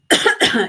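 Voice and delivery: sharp loud cough; a sudden real cough mid-sentence. Real, not acted. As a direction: cough it out hard. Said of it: "Yeah, I'm coughing now".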